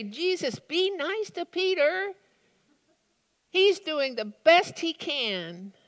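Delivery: high, pleading appeal to Jesus; pleading for Peter. Pretend. Preacher pleading with Jesus to spare Peter, high. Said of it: "I find myself wanting to say".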